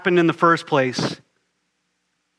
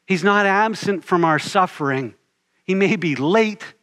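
ordinary delivery

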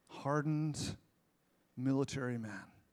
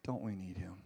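"Don't we need him?" repeated softer and low; 4 semitones lower, 6 dB quieter than usual. Shown as a demonstration. Pastor asking the congregation again, softer and low. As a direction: let the question sink in softly. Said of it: "repeated softer".

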